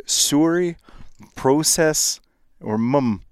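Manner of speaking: "sorry" as "soo-ree", "process" as "pro-sess", "mum" with uh; Canadian pronunciation. Shown as a demonstration. American summing up, "sorry", "process", "mum" said Canadian-style. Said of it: "they're from Canada".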